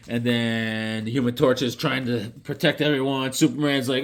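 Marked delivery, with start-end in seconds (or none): none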